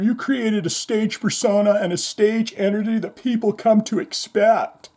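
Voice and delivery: lightly imitating the radio host; Eddie Trunk voice. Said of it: "And you said".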